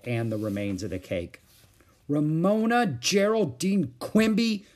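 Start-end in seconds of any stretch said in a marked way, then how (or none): 2.1-4.6 high, slow full-name scolding; angry. A narrator's reading. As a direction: stretch out each name angrily. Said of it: "said Beezus angrily".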